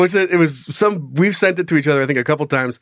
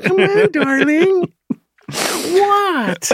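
very high put-on coaxing; high parrot-style cooing; parrot impression. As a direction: coax in a squeaky high mimic voice. Acting out parrot video.

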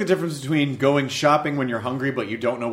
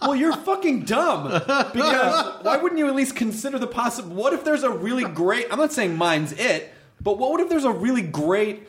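high-pitched mock outrage, pleading the case; exasperated retort; 7 semitones higher than usual. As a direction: argue back, exasperated and high. Retelling his retort to network executives.

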